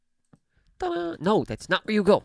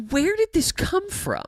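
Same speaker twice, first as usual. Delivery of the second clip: high, slowed, lightly mock puzzlement; puzzled inner voice. Retelling her confusion at sudden stage fright, puzzled voice. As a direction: wonder aloud, half-amused. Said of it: "it's like".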